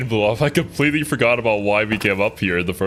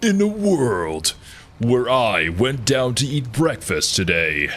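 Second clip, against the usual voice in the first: slow, dramatic trailer narrator; movie trailer voice; slower than usual. Acted. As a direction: announce it like an epic film trailer. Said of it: "the action movie trailer voice".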